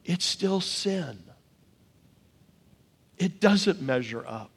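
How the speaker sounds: soft, slow, with weight on still; hushed and slow. Acted. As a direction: let it fall quietly: it's still sin. Sermon point delivered hushed.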